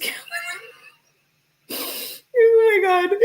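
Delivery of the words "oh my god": high-pitched laughing cry; helpless laughter, near tears. Real, not acted. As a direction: squeal it through laughter. Laughing hard.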